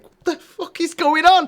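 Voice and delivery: high, slow disbelieving squawk; high mock bewilderment; baffled teen. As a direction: squeak it out in shocked disbelief. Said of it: "all that stuff was just like".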